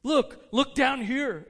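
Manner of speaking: slow, high, loud exclamation; exclaimed command. Acted. The ghost orders Scrooge to look down, exclaimed. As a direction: call out commandingly, stretching each word. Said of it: "exclaimed the ghost".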